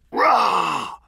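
loud, high "rah" roar; hollered roar. A narrator's reading. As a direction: lunge with a sudden scare shout.

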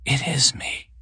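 hushed, breath-only reply; whispered. A narrator's reading. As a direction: whisper it gently to him. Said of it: "she whispered".

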